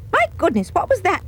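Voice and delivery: high startled gasp of a question; mother jolted by a noise from the tummy; startled cry. Acted. Shocked mother hearing the tummy beast, high and startled. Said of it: "she cried".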